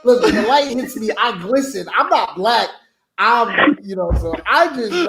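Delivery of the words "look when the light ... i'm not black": comic put-on African accent. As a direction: plead your case in a playful African accent. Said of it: "I revert to my African accent immediately".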